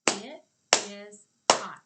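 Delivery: each word called loud and separate; big loud classroom chant; clapped out loud. Shown as a demonstration. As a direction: shout it word by word with claps. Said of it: "and clap".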